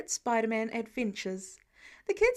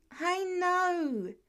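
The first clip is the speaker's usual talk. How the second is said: over the shoulder; 7 semitones higher than usual. A narrator's reading. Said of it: "says over his shoulder".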